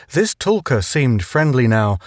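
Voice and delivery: usual speaking voice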